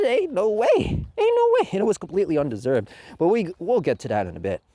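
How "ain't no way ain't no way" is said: disbelieving. Retold or imitated. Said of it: "I'm looking at them like".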